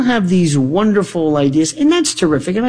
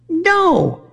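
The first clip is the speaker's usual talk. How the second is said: a high, sharp no; flat refusal; 12 semitones higher than usual. Real, not acted. Host rejecting the crazy excuse, sharp and high. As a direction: shut the objection down.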